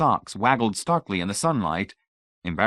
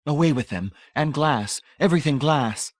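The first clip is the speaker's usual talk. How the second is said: raised, high, zealous command; excited; 7 semitones higher than usual. A narrator's reading. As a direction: denounce it in mounting excitement. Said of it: "in her excitement".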